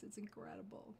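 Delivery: hushed marveling; soft awed whisper. Real, not acted. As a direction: whisper in awe. Marveling at the glass-like jade.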